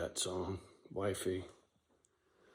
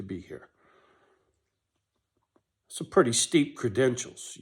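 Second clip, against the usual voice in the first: impassioned; 3 semitones higher, 8 dB louder than usual. Real, not acted. To future housemates, loud fervent demand. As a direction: drive it home with fervor.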